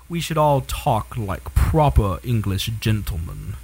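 slow, low posh gentleman voice; proper English gentleman. Pretend. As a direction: enunciate every syllable slowly. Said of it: "that was really good".

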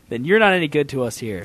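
ordinary delivery

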